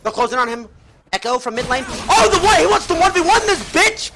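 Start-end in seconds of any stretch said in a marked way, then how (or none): 1.9-4.1 high, loud burst of live commentary; excited shout. Real, not acted. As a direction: erupt at the sudden play, pitch jumping up. Reacting to a sudden attack.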